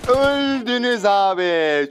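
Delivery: slow, high triumph; triumphant. Real, not acted. Gloating to the squad he just wiped out. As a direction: savour the win.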